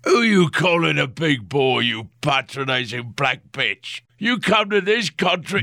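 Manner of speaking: put-on high, leering drunken man; leering drunk reporter voice. A narrator's reading. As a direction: slur it like a boozy hack. Reporter's angry retort.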